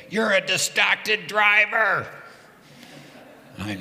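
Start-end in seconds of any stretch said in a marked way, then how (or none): 0.1-2.1 slow, loud, higher accusing yell; retold yell. Runner yelling at the passing cyclist, slow loud yell. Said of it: "she yelled at me".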